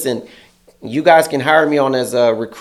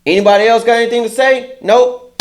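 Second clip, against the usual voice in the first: mock boss challenge; 9 semitones higher, 4 dB louder than usual. Manager challenging crew.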